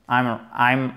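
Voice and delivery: ordinary talk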